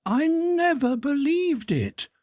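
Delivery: put-on high boyish voice, just waking; a small son, pleased and drowsy; little boy's voice. A narrator's reading. Son waking to his mother, high boyish voice.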